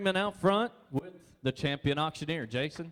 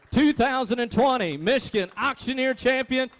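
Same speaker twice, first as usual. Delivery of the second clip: ringing, raised announcer voice presenting the champion; announcer introduction; 7 semitones higher, 4 dB louder than usual. Real, not acted. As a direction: proclaim the title, then land on the name.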